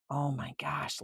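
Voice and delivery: ordinary delivery, nothing marked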